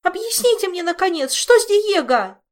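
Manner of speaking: high, very loud frantic demand; yelling Olga. Acted. Olga demanding news of Diego, frantic high yell. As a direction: scream it on the edge of hysteria. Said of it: "заорала Ольга".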